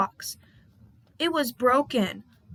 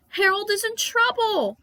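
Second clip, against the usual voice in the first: higher, louder alarm; alarmed cry; 10 semitones higher, 4 dB louder than usual. Acted. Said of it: "Percy cried".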